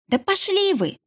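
high sharp yell; крик. Acted. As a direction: snap back with a high shout. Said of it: "заорала в ответ Нина". (высокий резкий крик)

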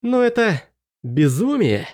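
high, slow disbelief; incredulous. A narrator's reading. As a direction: object in disbelief. Prince objects.

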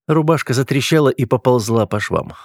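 usual speaking voice

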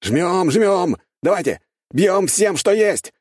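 high, loud battle commands; urgent rallying shout. A narrator's reading. Gunners urging each other to fire everything, rallying shout. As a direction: urge them on at full voice.

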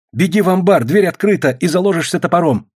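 high-pitched urgent instructions; urgent warning. A narrator's reading. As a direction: urge someone to run for safety. Urgent instructions from the hidden friend.

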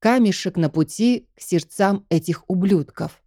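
ordinary delivery

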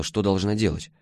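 usual speaking voice

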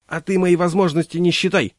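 flaring up. Acted. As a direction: bristle at him and threaten to put him off. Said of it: "взвилась кондуктор".

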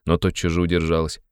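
ordinary delivery, nothing marked

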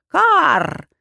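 high raven caw, voiced by the narrator; sharp bird call; crow caw. A narrator's reading. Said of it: "сказала птица".

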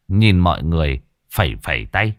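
plain reading voice

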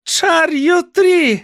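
very high, slow, drawn-out hag's howl; Cordelia howling. A narrator's reading. Aunt screeching at her niece on the cart, high crazed howl. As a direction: stretch the chant out in a shrill crazed screech. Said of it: "загорлала Корделія Дельгадо".

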